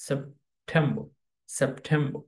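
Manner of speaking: সেপ্টেম্বর with silent r, "sep-TEM-bo"; careful syllable model of সেপ্টেম্বর without final r; correct English pronunciation. Shown as a demonstration. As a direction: pause after "sep" in সেপ্টেম্বর, stress "tem". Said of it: "সঠিক উচ্চারণ হচ্ছে".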